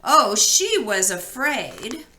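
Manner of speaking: ordinary delivery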